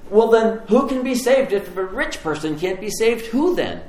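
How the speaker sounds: high-pitched, incredulous questioning; acted alarm, voice jumping up; freaked out. Disciples asking Jesus who can be saved, freaking out. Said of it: "the disciples freak out".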